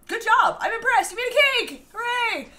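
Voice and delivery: high-pitched, delighted praise; high little hooray of approval; cheering congratulations. Real, not acted. Cheering on a viewer's cake.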